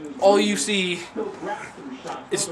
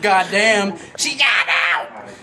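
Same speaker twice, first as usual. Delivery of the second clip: mock yell; slower than usual.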